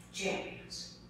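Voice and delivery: whispered. Acted. Speaker's opening word to the audience.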